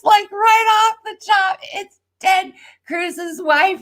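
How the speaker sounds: giddy breaking-news shout; excited. Real, not acted. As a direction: crow over the big reveal. Bombshell tarot reveal to viewers.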